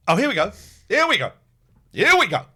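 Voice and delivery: a loud "Here we go" with a falling tune. Real, not acted. Launching into the buyer's story, a loud here we go. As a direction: announce it, bracing for trouble.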